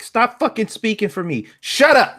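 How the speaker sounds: high-pitched, fed-up outburst; exasperated retort. Retold or imitated. Ranting retort to article.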